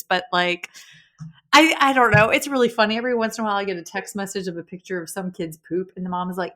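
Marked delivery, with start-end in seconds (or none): none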